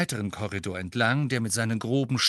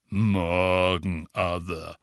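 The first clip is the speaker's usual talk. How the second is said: lower, gloomy graveyard tone; funereal voice; 5 semitones lower, much slower than usual. A narrator's reading. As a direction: greet like an undertaker. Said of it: "sagte er mit Grabesstimme".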